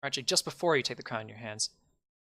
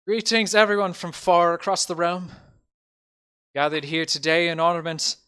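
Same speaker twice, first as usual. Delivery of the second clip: loud, raised public-speech voice; festival mayor, proclaiming; 6 semitones higher, 8 dB louder than usual. Acted. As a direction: address the whole realm from the podium. Game master voices the mayor's speech.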